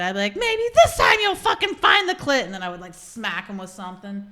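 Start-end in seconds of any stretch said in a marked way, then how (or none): 0.4-2.4 loud, high scolding dominatrix; bossy dominatrix. Acted. Dominatrix berating a partner in role play, loud scolding. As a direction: belt it out, all demanding and contemptuous. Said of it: "I would be all dominatrix about it".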